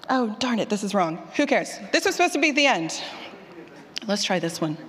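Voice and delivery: usual speaking voice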